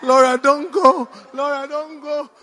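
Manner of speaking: high mock-weeping plea, fading away; tearful pleading. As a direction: wail it tearfully, each plea weaker. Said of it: "with tears".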